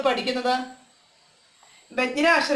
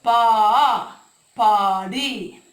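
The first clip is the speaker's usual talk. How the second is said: very slow, stretched പാടി; teacher slowly modelling പാടി; drawn out slowly; 3 dB louder, much slower than usual. Shown as a demonstration. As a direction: stretch പാടി long for the class. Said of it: "ആ ചേർന്നപ്പോ എന്തായി?".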